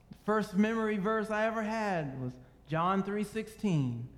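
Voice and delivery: ordinary talk, nothing marked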